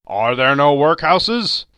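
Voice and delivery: loud, slow, rising scornful question; mocking echo. A narrator's reading. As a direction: throw his own words back at him. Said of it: "turning on him ... own words".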